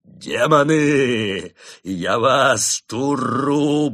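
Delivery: a monk calling out to demons, ecstatic. A narrator's reading. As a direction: proclaim it to the dark.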